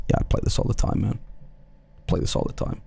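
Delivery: whispered, nonchalant brag; cool whisper. Retold or imitated. Bragging to the kids in a casual whisper. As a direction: play it cool under your breath.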